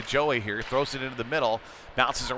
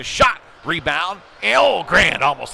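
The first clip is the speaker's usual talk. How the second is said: building from the shot to a loud, higher "Oh"; excited call; 6 semitones higher, 10 dB louder, slower than usual. Real, not acted. Announcer calling a near goal on the rebound, building excitedly. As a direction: ride the scoring chance up to a near-miss cry.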